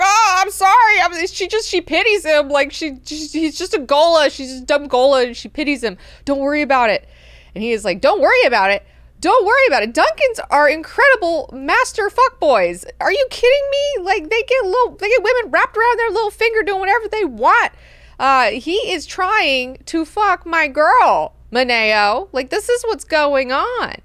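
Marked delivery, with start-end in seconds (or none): 0.0-7.1 high, loud mock panic; hammed-up frantic backpedalling; flustered apology. Scared servant making excuses to his lord. 8.0-17.7 very high, loud mock outrage; hammed-up indignant shouting; outraged retort. Angry lord throws servant's words back. 18.2-23.9 loud, high mock indignation; hammed-up exasperated complaint; jealous rant. Jealous lord rants at servant.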